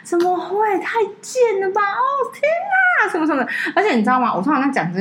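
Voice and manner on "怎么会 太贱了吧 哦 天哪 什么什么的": 音调猛地拔高的惊呼; 装出难以置信的样子大呼; 夸张惊呼. Retold or imitated. Retold outraged reaction to friends. (高八度的夸张惊叹)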